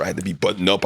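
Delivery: usual speaking voice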